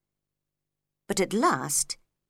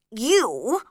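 unfriendly; 4 semitones higher, 4 dB louder than usual. A narrator's reading. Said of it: "in a very unfriendly voice".

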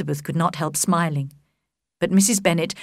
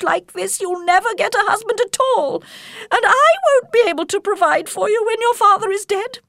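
much higher, agitated mother voice; a flustered mother begging for help, pitched up; fussy Mrs Bennet; 13 semitones higher than usual. Acted. Mrs Bennet's long complaint.

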